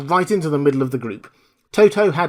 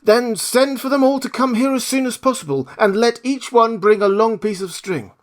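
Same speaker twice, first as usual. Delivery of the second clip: higher Scarecrow voice; 10 semitones higher, 3 dB louder than usual. Acted. Scarecrow asks the Queen about her mice, higher voice. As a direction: ask it as the straw man.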